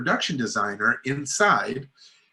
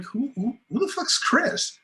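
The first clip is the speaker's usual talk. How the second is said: much higher, mock-indignant squeak; incredulous; 12 semitones higher than usual. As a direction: play up the disbelief, pitch shooting up. Retold indignant question to Debbie.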